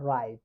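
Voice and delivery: রাইট with a clear initial r; R pronounced. Shown as a demonstration. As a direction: sound the r starting রাইট. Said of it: "আর এর উচ্চারণ হবে". (র উচ্চারণ করে রাইট)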